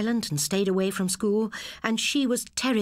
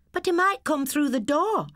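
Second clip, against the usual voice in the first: put-on worried boy; 10 semitones higher, 4 dB louder than usual. Boy worries about burglars.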